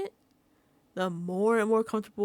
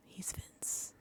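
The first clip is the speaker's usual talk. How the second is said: whisper; 15 dB quieter than usual. Real, not acted.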